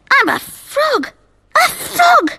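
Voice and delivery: shocked cry. Pretend. Simon finds he is a frog, shocked. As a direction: gasp out the discovery.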